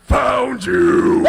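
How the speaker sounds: menacing shout; jump-scare shout. Acted. Killer finds hiding victim.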